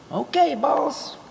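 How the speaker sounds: high, slow dopey yes-man; the helper Bruce agreeing to go for beer; mock helper reply. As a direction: answer the boss like a dim sidekick.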